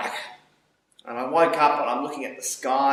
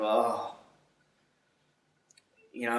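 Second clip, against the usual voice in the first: usual speaking voice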